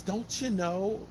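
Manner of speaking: slow, high Minnesotan lilt; Minnesota accent. Retold or imitated. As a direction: imitate a Minnesota local, high and drawn out. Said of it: "when you talk to people in Minnesota".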